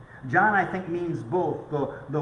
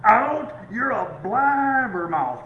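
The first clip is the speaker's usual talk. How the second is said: furious shout, peaking high and loud on Out; hammed-up outburst throwing her out; screaming Ralph; 6 semitones higher, 7 dB louder, slower than usual. Ralph throwing the blabbermouth mother-in-law out, shouting. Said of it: "he just starts screaming in her face".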